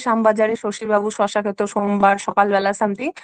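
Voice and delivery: লাইনের প্রতিটা শ-তে ঝোঁক দিয়ে; শ্যামবাজারে শশীবাবু শশা, every শ hit; tongue twister recited. Shown as a demonstration. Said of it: "এখানে বারবার এখানে স সাউন্ডটা". (শ-এর অনুপ্রাস জোর দিয়ে বলা)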